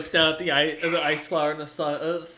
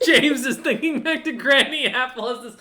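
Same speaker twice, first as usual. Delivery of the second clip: laughing narration; 9 semitones higher, 7 dB louder than usual. Real, not acted.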